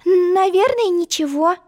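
put-on high, hesitant girlish answer; young girl voice. Alice answering.

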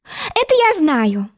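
eager. Acted. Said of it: "воскликнула".

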